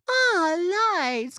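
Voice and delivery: high, airy mock ditz; ditzy friend. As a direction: squeal it like a dazzled tourist. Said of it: "she's just".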